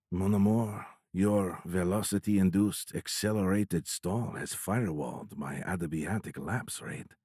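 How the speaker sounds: put-on soft, seductive French accent; whispered French accent. A narrator's reading. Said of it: "In a French accent, he whispered".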